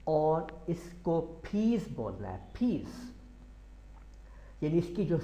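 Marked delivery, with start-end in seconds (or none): 1.4-3.1 फीस with a puffed p and a z ending; फीस said like "feez" with a breathy p; aspirated p. Shown as a demonstration. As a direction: blast the p in फीस. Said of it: "blast करना है फी".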